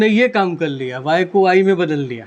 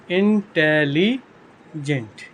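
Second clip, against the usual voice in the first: Intelligent slowly split as इन-टे-ली-जेंट; syllable by syllable; 3 semitones lower, much slower than usual. Shown as a demonstration. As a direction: stretch intelligent out in separate syllables. Teacher breaks word into syllables.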